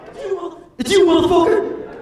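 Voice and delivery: high, loud outraged yell; shout. Retold or imitated. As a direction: shout it at him. Recognising the caller.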